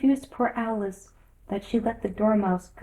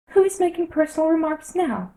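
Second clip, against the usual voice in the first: higher, louder gloating question; triumphant Hatter; 9 semitones higher, 5 dB louder than usual. A narrator's reading. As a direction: crow over your little victory. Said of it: "the Hatter asked triumphantly".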